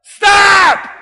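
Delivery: high, booming shouted command; loud voice. Acted. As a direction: cut in with a sudden loud shout. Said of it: "he hears a loud voice".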